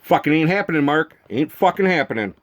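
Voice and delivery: ordinary talk, nothing marked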